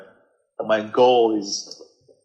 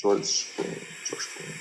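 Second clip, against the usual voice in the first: whisper; 12 dB quieter, faster than usual. Shown as a demonstration. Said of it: "I'm gonna try to talk like an American".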